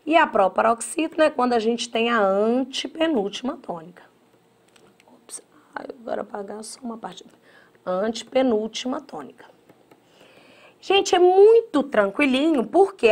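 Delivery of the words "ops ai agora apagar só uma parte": whispered aside. Real, not acted. Teacher mutters aside while erasing.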